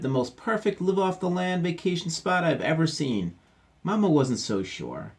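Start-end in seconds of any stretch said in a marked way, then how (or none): 0.0-3.4 excited. Acted. Papa still shouting.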